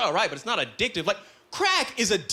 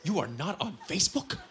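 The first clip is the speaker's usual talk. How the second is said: low, softer, mock-solemn friend; grave warning; 6 semitones lower, 6 dB quieter than usual. Friends warning him about Facebook, mock grave. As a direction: lower it into a hushed mock caution.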